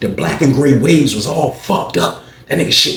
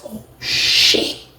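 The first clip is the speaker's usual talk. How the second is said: retold hushed shock; 7 semitones higher, 4 dB quieter than usual. Retold shock at angry cellmate.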